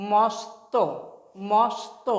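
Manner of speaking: "মস্ত" in two beats, s closing the first; joined স্ত. Shown as a demonstration. Teacher showing how it is pronounced, মস্ত as "mos-to". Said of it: "এটা উচ্চারণ কেন হবে".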